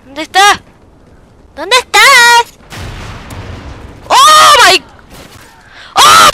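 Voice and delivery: high, loud disbelieving yells; frantic shouting. Real, not acted. Enemy won't die frustration.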